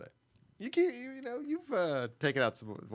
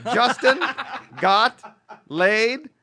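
laughing; 10 semitones higher, 13 dB louder, much slower than usual. Acted. Reading listener email in voice.

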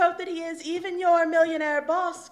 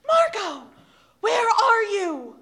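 calling out; 4 semitones higher, 7 dB louder, slower than usual. Acted. Calling for missing friend.

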